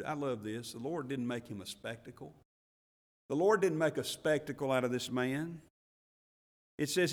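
ordinary delivery

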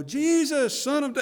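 loud, high call cut short; hollering. Retold or imitated. Blind man hollering at Jesus, loud and high. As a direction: holler his name over the crowd. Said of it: "this guy's just".

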